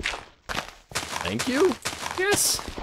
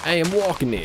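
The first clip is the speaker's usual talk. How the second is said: louder, higher mock Italian-American New Yorker; Italianish New York accent; 8 semitones higher, 4 dB louder than usual. Describing a New York accent with a stock line, Italianish New York voice. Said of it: "Kind of, like, Italianish".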